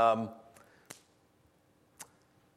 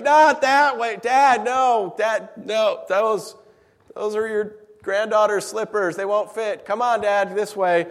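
higher, louder coaxing of a confused dad; patiently herd a wandering father, voice raised; loud pleading son; 6 semitones higher, 6 dB louder, slower than usual. Retold or imitated.

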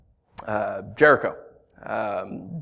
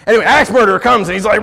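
quick and louder, building the scene; excited, fast storytelling; 8 semitones higher, 7 dB louder, faster than usual. Real, not acted. Preacher hurrying into the axe murderer story, faster and louder. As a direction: rush the setup with energy.

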